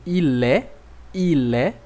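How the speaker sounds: "il est" with the T silent; "il est" ending in an open e; no T sound; silent final T. Shown as a demonstration. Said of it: "isso pode acontecer com a letra T".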